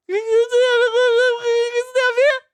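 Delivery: very high, disbelieving question; incredulous. Real, not acted. Questioning the lawsuit's logic to viewers. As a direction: squeak out the disbelief.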